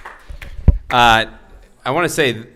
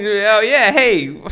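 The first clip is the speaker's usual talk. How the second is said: high, loud mock teenage bravado; overeager bluffing; 13 semitones higher, 7 dB louder, much slower than usual. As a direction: act out an overeager kid bluffing. Teen bluffing the tequila seller.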